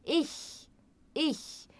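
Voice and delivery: two separate, careful takes of "ich" with a soft ch; soft German ich sound. Shown as a demonstration. Teacher showing how to make this sound, soft ich. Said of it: "coloque a língua atrás dos dentes inferiores".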